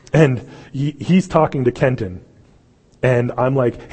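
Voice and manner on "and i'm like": usual speaking voice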